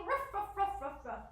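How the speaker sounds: quick run of ruffs falling in pitch; dog bark. Shown as a demonstration. Teacher barking along with children, quick playful ruffs. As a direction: start high and bark like a doggy. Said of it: "Can you ruff like a doggy?".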